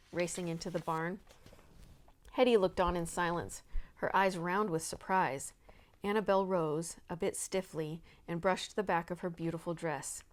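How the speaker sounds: ordinary delivery, nothing marked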